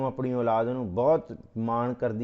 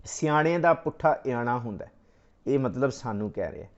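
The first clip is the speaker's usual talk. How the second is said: normal talking voice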